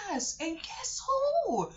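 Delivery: high slow sing-song guess who; teasing child talk. Retold or imitated. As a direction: draw it out for a kid. Talking sweetly to little kids.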